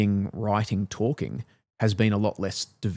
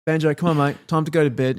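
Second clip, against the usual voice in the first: put-on calling toddler; 7 semitones higher, 4 dB louder, faster than usual. Dad calling toddler to bed.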